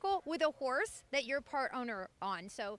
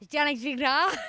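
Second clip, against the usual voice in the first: put-on jokey French accent on the name, said louder; 8 dB louder than usual. Host teasing the driver with a mock French accent. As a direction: roll the name out like a Frenchman, hamming it up. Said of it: "How'd you like that for a French accent".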